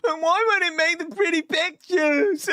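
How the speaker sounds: very high, squeaky mock whine; mock lament. Streamer whining about the missing pictures, mock lament in squeaky voice. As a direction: put on a silly high voice to complain.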